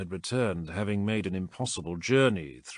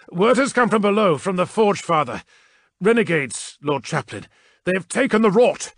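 loud, much higher vox report; urgent report; 10 semitones higher, 9 dB louder than usual. A narrator's reading. Krask reporting renegades over the vox, loud and urgent. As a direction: report the emergency at full volume.

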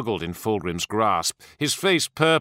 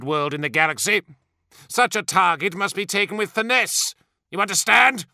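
high, imperious tirade; haughty, ringing outrage; enraged rant; 7 semitones higher than usual. A narrator's reading. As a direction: assert your greatness in fury. Enraged primarch rants at officer.

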